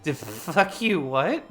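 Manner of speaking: high stammer that bursts into a loud what; stammer, then blurt it out in shock; stuttering. Retold or imitated. Kristen Wiig hearing the rent, stuttering then blurting. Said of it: "she's, like, stuttering".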